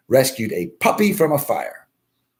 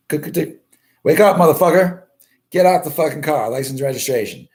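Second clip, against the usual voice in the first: higher, louder barking cop; 5 semitones higher, 3 dB louder than usual. Retold or imitated. Police officer waking the drunk driver, mock bark. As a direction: play the cop banging on the window.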